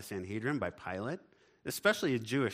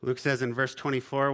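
ordinary talk, nothing marked

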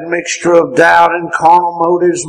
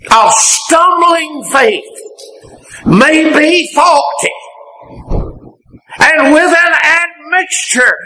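preaching, repeated; 13 semitones higher than usual. Shown as a demonstration. Preacher repeating the line with more fire. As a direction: say it again with full force. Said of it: "I just want to say that again".

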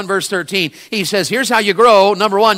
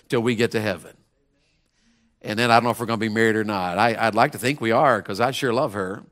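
lower, softer, gentle confession; tender aside; 7 semitones lower, 6 dB quieter than usual. Real, not acted. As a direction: speak fondly of his wife. Tender aside about his wife.

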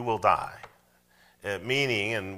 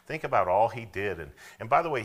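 ordinary talk

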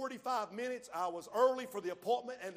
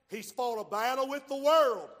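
loud proclaiming; loud preaching; 3 semitones higher, 9 dB louder than usual. Real, not acted. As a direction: belt it to the back row. Preaching climax.

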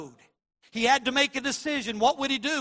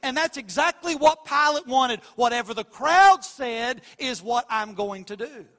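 louder, driving preacher's cadence; preaching at full force; 2 semitones higher, 6 dB louder than usual. Acted. Preacher warns church against neutrality, preaching at full force. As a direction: pound the point home to the church.

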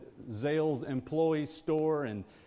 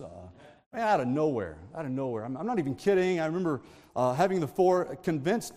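ordinary delivery

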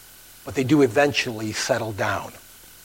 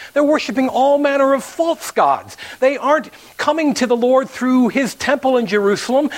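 full-force preaching; 9 semitones higher than usual. Real, not acted. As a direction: preach it with rising fire.

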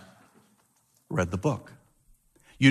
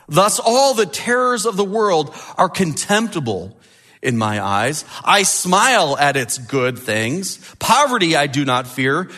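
bold declaration; 6 semitones higher than usual. A narrator's reading. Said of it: "he said these famous words".

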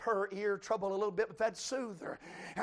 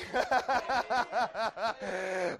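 a short delighted laugh; joyful laugh; 3 semitones higher than usual. Real, not acted. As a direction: laugh out in joy. Joyful laugh mid-sermon.

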